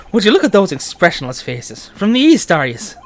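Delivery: lilting Irish character voice; Irish accent. Acted. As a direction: size up strangers in a broad Irish accent. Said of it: "I'm shouting in an Irish accent".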